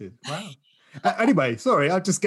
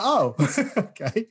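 laughing; 7 semitones higher, slower than usual. Retold or imitated. Retelling his confused reply.

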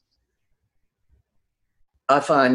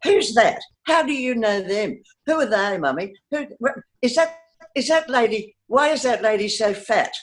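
put-on high, eager child's voice firing off questions; little girl asking questions; 7 semitones higher than usual. Little daughter pestering her mother on a tram, in a high, eager child's voice. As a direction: play yourself as a curious little kid tugging at Mum. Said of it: "I never stopped asking questions".

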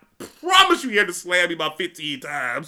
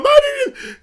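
a very high "Man" cracking into a laugh; 7 dB louder than usual. Retold or imitated. About to tell the principal, laughing high. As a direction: start to speak and break up laughing.

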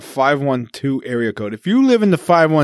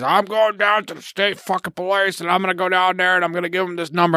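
imitating grandfather's thick Vermont accent, pitched higher; higher, heated Vermont grandpa impression; 6 semitones higher than usual. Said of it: "being the Vermont thick accent he is".

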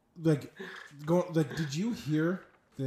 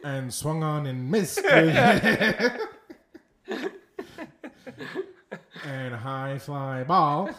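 baseball commentator through laughter; lightly voiced play-by-play announcer; 5 dB louder, slower than usual. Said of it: "such a baseball voice".

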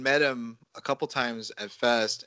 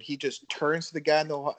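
ordinary talk, nothing marked

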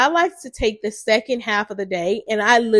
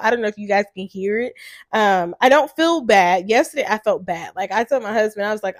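ordinary delivery

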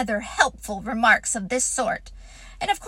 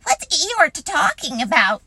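plain reading voice

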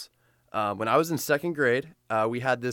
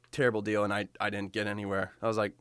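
ordinary talk

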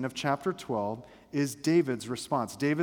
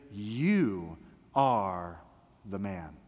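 grave confrontation; 7 semitones lower, much slower than usual. Acted. Nathan accusing King David to his face, grave confrontation. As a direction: let each word land as a solemn charge. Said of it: "one of the most powerful confrontations".

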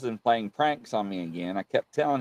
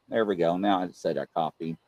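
ordinary talk

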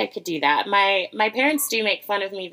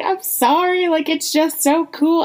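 put-on gushing teen; 8 semitones higher than usual. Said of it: "And I was like".

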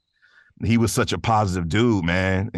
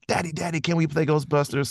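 put-on high, pleading child voice; eager little daughters; 8 semitones higher than usual. Daughters begging dad to play Ghostbusters, high pleading kid voice. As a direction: beg daddy like an excited kid. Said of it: "and they're like".

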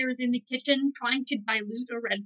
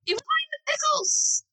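much higher, squealed explanation; high-pitched insistent cry. Acted. Acted popsicle quarrel.

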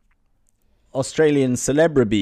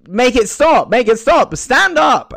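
loud, high-pitched frantic plea; hammed-up screamed demand; desperate shout; 9 dB louder than usual. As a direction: yell over an unbearable screech. Charlotte can't bear the screaming.

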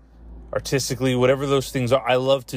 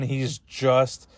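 ordinary talk

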